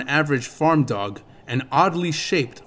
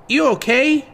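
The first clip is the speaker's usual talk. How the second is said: much higher little fairy voice, concerned; a tiny fairy checking on her friend, pitched up high; Navi, concerned; 12 semitones higher, 4 dB louder than usual. A narrator's reading. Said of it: "Navi asked him quietly".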